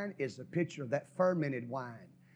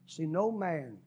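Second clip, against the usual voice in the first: usual speaking voice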